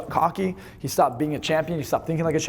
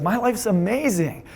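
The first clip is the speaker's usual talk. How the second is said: higher, drawn-out delight on amazing; happy, excited; 4 semitones higher, much slower than usual. Shown as a demonstration. Coach showing how you'd describe a perfect life, excited. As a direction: stretch it out with excitement. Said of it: "You'd be happy. You'd be excited".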